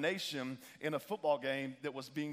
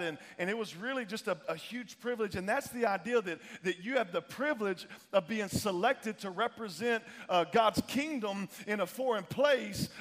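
higher, driving sermon voice; impassioned pulpit exhortation; fired-up preaching; 5 semitones higher, 4 dB louder than usual. Real, not acted. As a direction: preach it hard with punched key words. Preaches at rising force.

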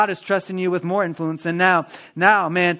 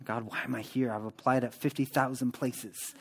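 much softer, a worn-out plea to God; hushed weary prayer; 6 semitones lower, 13 dB quieter than usual. Acted. Worker stuck at a dead-end job, praying softly and wearily. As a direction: murmur it like a tired complaint under your breath.

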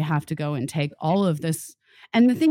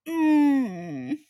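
shaky sigh; 7 semitones higher, 4 dB louder than usual. Real, not acted. Tearful over the news.

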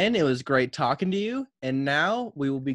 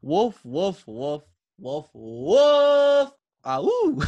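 dog barking into a howl; 3 semitones higher, 5 dB louder than usual. Acted. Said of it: "reading it from the perspective of Duke Bush".

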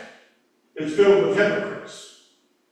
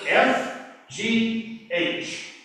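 slow spelled letters; 4 semitones higher, much slower than usual. Shown as a demonstration. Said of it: "what I call alphabetic".

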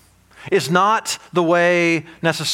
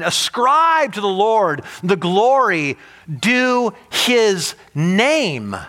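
exalted reading; 3 semitones higher, much slower than usual. Acted. Reads psalm in exalted tone.

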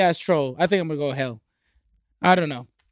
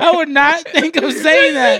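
high, loud, laughing through it; can't stop laughing; 8 semitones higher, 6 dB louder, slower than usual. Real, not acted. Friend laughs at how the name was said.

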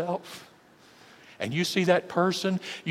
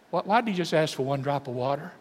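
usual speaking voice

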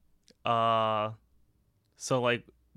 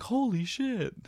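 bystander reaction; 11 semitones higher, slower than usual. Retold or imitated. Onlookers reacting to a crash.

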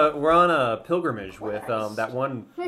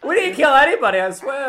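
nervous pleading; 12 semitones higher, 6 dB louder than usual. Acted. Player pleads innocence to the giant.

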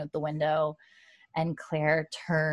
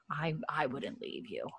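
soft, hushed whisper, much softer; whispered vow; 12 dB quieter than usual. Retold or imitated. Daughter quoting her mom's vow, whispered. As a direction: breathe it like a private promise.

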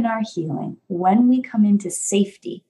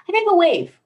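put-on high, small child voice; child's answer; 11 semitones higher than usual. Toddler answering the parent, high and small.

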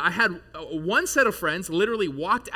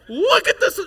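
high, loud mock yell; hammed-up outrage at the splatter; mock outraged shout; 11 dB louder than usual. Wants everyone ruined; doesn't yell.

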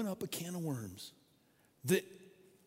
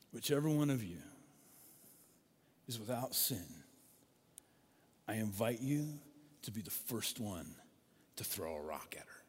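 softer and lower, fading to a whisper; hushed, solemn Jesus; 6 semitones lower, 6 dB quieter than usual. Retold or imitated. Jesus calmly challenging the accusers, fading into a whisper. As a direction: let it sink to a whisper.

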